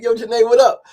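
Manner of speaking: high, loud shout hello; loud mimed greeting. Retold or imitated. Turning round to greet Janae on the escalator, loud. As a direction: call back heartily, re-enacting it. Calling back to her greeting.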